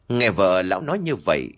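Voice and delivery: ordinary delivery, nothing marked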